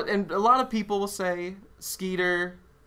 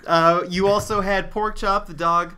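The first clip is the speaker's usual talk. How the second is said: normal talking voice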